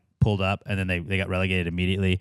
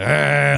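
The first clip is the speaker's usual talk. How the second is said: playful mock dog growl, a bit louder; imitated dog growl; 4 semitones higher, 4 dB louder than usual. Retelling his dog greeting another dog, imitated growl. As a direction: growl like a small, noisy dog. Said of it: "growled at it".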